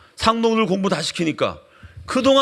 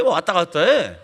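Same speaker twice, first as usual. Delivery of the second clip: ordinary talk, nothing marked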